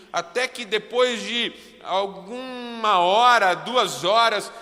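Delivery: impassioned preaching. Acted. Preacher telling the church about the furious pastor, in loud, high impassioned preaching. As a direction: drive the story to its peak with force.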